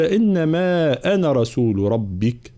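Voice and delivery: slow chanted tajweed recitation; slow Quran chant; Quranic recitation. Shown as a demonstration. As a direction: recite it measured and melodic.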